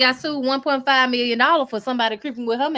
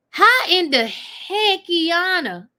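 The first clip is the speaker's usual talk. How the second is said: slow disbelieving exclaim; 5 semitones higher, 4 dB louder, much slower than usual. Real, not acted. Scolding Tamar in disbelief.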